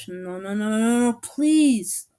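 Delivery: slow, drawn-out begging; hammed-up protest; groaning plea. A narrator's reading. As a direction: swell into a loud whiny groan. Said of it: "Marcus groaned".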